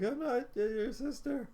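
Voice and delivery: mock scream. Gamer retelling the melodramatic death scene, high mock scream. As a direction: imitate a character's scream without real volume. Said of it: "starts screaming".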